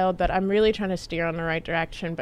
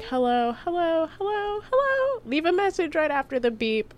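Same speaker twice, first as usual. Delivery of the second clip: climbing ever higher, like a chipmunk; chipmunk hello; 10 semitones higher than usual. Retold or imitated. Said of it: "we sounded like Alvin and the Chipmunks".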